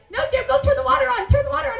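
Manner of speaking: high, fast and laughing; giddy urging. Retold or imitated. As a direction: push him to the faucet through giggles. Urging brother while laughing.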